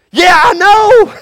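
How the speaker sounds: loud, high, slow joyful shout; elated healed man. Acted. Healed man answering amazed neighbours, shouting joyfully. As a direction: burst with joy.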